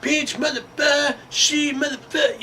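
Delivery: very high, hammed-up taunting voice; mock gloating taunt. Hire taunting the company, very high mocking voice. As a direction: jeer it way up high. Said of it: "being like".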